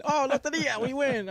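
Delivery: high, gloating fake win; mock cheater voice. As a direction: squeak out a smug victory. Mocking a cheating relative.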